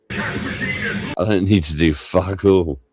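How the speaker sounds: ordinary talk, nothing marked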